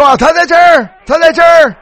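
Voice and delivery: high, loud shout across the hall; loud calling out. Retold or imitated. Man giving away the hiding preacher to everyone, shouting. 旁人大声指认他.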